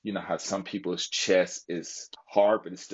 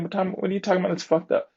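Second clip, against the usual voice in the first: much higher and fast, outraged; indignant retort; 12 semitones higher, 3 dB louder, faster than usual. Retold or imitated. Vlogger retelling how he snapped back at his fellow airmen, much higher, fast and indignant. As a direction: fire back at the accusation. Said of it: "I'm saying".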